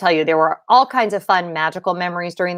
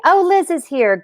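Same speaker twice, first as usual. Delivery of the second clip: much higher, happy hello; pleased surprise; 10 semitones higher, 3 dB louder than usual. Real, not acted. Greeting Liz as she joins the stream, pleased. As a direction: brighten up at seeing a friend arrive.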